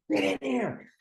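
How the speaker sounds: high-pitched pretend shout; retold scream. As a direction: imitate urgently screaming for your wife. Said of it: "I'm screaming to my wife".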